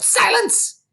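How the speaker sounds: very high shrill yell. A narrator's reading.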